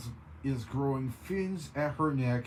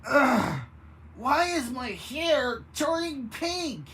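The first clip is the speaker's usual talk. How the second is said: loud, high screech; 11 semitones higher, 5 dB louder than usual. A narrator's reading. Baroness screeches about her pink hair. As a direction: screech it out in dismay. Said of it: "Baroness von Bon Bon screeched".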